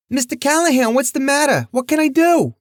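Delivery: put-on high anxious young waitress; eager, worried diner waitress.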